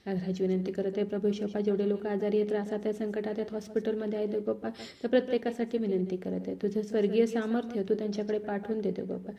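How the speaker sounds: hushed intercession, addressed to God; heartfelt prayer. Real, not acted. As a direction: pray earnestly, softening as you plead. Leads a closing prayer.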